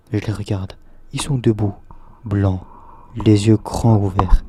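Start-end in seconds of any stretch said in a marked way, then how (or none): none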